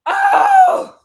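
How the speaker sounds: high, loud shocked cry; shout. Real, not acted. Vlogger reacting to a prediction, loud shocked shout. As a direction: yelp it out in shock.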